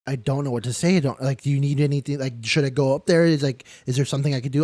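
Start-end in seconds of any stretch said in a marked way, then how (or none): 1.4-4.6 higher, louder, fast panicked questions; retold panic. To the staff after the news, retold panicked rush. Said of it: "I started freaking out".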